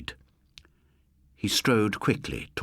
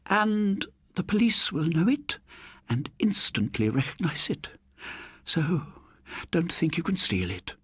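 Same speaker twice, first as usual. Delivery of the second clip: high, fussy spinster scolding; genteel elderly lady, mildly cross; prim old lady; 5 semitones higher than usual. Acted. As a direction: tut at a naughty man. Old lady confronts murderer.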